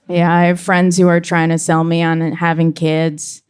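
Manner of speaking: usual speaking voice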